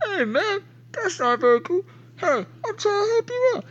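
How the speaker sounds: muffled, very high voice through a shut door; plaintive squeal heard from behind a door; Big Tim. Acted. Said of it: "muffled voice go".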